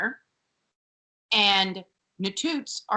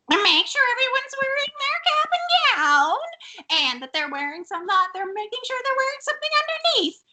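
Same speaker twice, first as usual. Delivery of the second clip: mock schoolmistress. Acts out Missus Garrett's fussy instructions.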